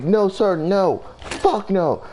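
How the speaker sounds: high, insistent protest; high-pitched denial. Retold or imitated. Driver denying racing to the cop, high-pitched protest.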